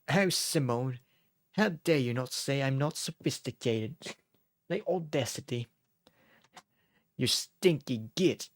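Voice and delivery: hammed-up British accent, slow and drawn out. Imagining Anna at a high-class party, mock British accent. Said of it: "imagine her with a British accent".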